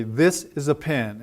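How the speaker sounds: "This" and "pen" stressed, "is a" tucked between; rhythmic model sentence falling on "pen". Shown as a demonstration. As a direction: beat on "This", quick "is a", beat on "pen". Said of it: "stressed on this and pen".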